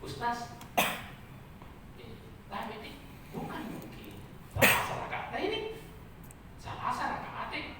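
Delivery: hushed, near-whispered and halting; hesitant correction. Acted. Colleague cautiously corrects the ustaz's reading, hushed. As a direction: softly query a colleague's mistake. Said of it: "dia ingatkan".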